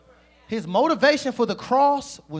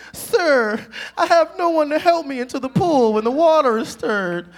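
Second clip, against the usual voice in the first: loud, high wailing complaint; whining excuse-maker; 7 semitones higher, 7 dB louder than usual. Retold or imitated. Preacher imitating the sick man answering Jesus, loud mock whine. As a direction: wail it out like a hammed-up victim.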